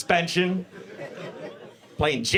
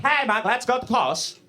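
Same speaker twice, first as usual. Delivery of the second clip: put-on a higher, lightly singsong Norwegian accent; mock Norwegian accent; 6 semitones higher than usual. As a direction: lightly mimic the Norwegian friends again. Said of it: "that's how they sound".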